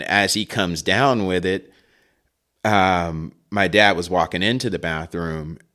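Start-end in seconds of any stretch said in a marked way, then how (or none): none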